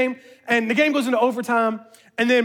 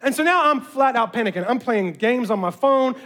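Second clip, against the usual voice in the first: ordinary delivery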